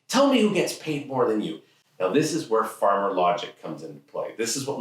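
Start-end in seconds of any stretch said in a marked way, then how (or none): 0.1-1.6 higher-pitched father's demand; challenging dad. Acted. Dad challenging teen over pay, demanding. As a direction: put the challenge like an argumentative dad.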